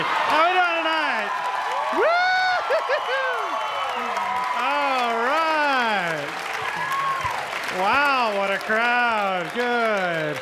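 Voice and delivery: high, slow crowd-warming hype; high, slow hyped-up host greeting the crowd; hype comic. Pretend. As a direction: work the crowd like a big-stage comic. Switches to hype comedian.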